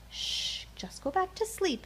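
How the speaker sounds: ordinary delivery, nothing marked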